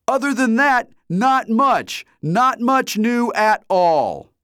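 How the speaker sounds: high, loud, drawn-out yelling; mock shout. A narrator's reading. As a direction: shout it right into the camera. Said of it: "leaned forward ... and shouted".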